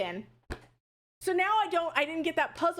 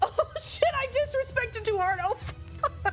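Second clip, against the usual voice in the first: panicked yelp. Real, not acted. Streamer reacts to the spreading fire, panicked high yelp. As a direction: shriek in real alarm.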